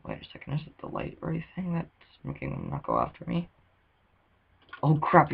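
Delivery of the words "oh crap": sudden loud startled curse; panicked outburst as mobs rush in; scared horror game streamer. Real, not acted. Spider mobs suddenly coming.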